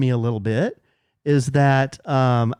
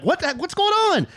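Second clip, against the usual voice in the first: high-pitched startled outcry; alarmed bystanders. Retold or imitated. Dog owners staring at the culprit's owner, high alarmed outburst. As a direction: blurt it as a baffled onlooker. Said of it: "dog owners looking at me like".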